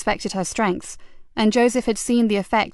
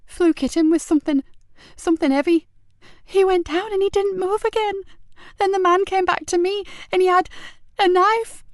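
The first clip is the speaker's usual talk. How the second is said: put-on high, gulping, tearful boy; near tears; 10 semitones higher than usual. Boy recounting the attack, choking back tears. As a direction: fight back sobs telling it. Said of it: "Between gulping air and trying not to cry".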